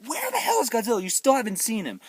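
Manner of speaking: high-pitched impatient complaint; mock exasperation. Viewer still waiting for Godzilla, mock exasperation. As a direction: demand it, pitched well up.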